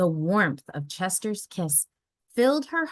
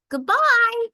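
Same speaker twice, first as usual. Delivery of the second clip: put-on high, long child goodbye; gentle child goodbye; 8 dB louder than usual. A narrator's reading. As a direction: wave goodbye like a small kid. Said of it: "with a gentle".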